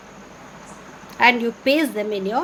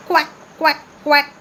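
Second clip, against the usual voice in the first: loud high quacking; duck quack imitation; 4 semitones higher, 5 dB louder than usual. Shown as a demonstration. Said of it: "Can you tell me some more sounds of birds?".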